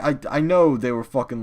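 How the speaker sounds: ordinary delivery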